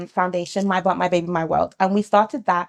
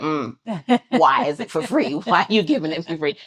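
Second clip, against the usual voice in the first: lightly mocked skeptic, dropping lower after a doubtful "mm"; suspicious New Yorker; 3 semitones lower than usual. New Yorker questioning a free scan offer, mildly mocked suspicion. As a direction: imitate a wary client who won't be fooled. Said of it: "They're very clued up".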